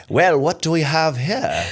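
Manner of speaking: slow, high, put-on voice; weird voice. Shown as a demonstration. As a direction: drag it out in a goofy character voice. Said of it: "a weird voice you've used?".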